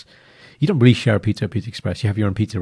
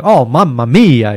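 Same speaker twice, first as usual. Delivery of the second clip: slow, loud, savouring mamma mia; mock delight; 3 semitones higher, 10 dB louder, much slower than usual. Eating ugly but delicious Italian pizza, slow loud delight. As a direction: relish it like the best bite ever. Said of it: "And you eat it, like".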